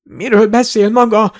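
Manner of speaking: high-pitched, mildly winded protest; gasping. A narrator's reading. Shoved wizard regaining his wits, gasping. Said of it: "zihálta a varázsló".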